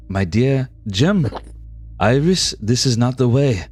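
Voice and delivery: put-on French accent. Rando calms Iris, low French accent.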